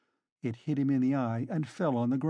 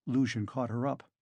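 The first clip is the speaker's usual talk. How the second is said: ordinary delivery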